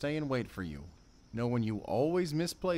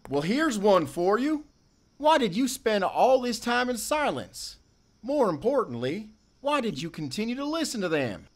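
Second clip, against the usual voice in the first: much higher and louder accusing shout; angry shout; 11 semitones higher, 7 dB louder than usual. Acted. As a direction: demand answers at full volume. Accusing quarrel over silence.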